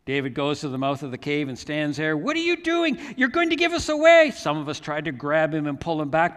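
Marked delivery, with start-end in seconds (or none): 2.2-4.3 high, loud panicked protest; alarmed outcry. Retold or imitated. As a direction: cry out in alarm to stop him. Alarmed men challenge David at cave mouth.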